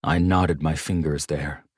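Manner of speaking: ordinary delivery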